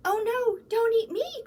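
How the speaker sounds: high, loud panicked goat cry; frightened cry. A narrator's reading. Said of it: "cried the littlest goat".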